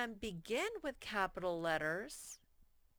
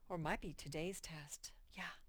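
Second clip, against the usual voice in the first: whispered aside; 7 dB quieter than usual. Real, not acted. Teacher's quiet aside.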